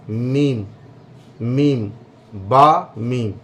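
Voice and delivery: मीम with the dry outer lips closed, humming m; teacher sounds out मीम against बा; demonstrated meem. Shown as a demonstration. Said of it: "तो मीम अदा होता है".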